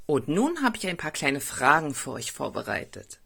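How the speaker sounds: ordinary delivery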